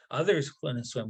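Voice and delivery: ordinary delivery, nothing marked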